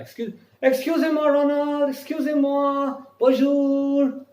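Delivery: high, loud call of "Excusez-moi" across a street; calling out to stop someone. Shown as a demonstration. Teacher shows how to stop someone in the street, calling out loud and high. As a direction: hail a passer-by from a distance. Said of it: "You can even stop people by saying that".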